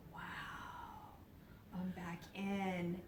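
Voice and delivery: slow, hushed wonder; awed whisper. Retold or imitated. Realizing she is back in her body, awed and whispered. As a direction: whisper it slowly in amazement.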